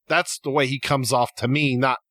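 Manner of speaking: ordinary talk, nothing marked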